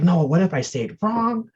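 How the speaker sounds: fretting aloud in a mock-worried voice; retold worry. Voicing own past worry about mistakes.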